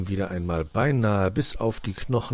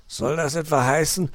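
higher-pitched, mildly irritated question; 7 semitones higher than usual. A narrator's reading. Uncle fox challenges his nephew, irritated. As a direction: ask it with a touch of irritation. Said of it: "zischte Herr Fuchs gereizt".

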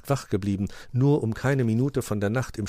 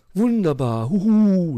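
loud, raised cheer with an owl hoot; praising; 10 semitones higher, 7 dB louder, slower than usual. A narrator's reading. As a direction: applaud with a high hoot. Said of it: "lobte ihn Onkel Uhu".

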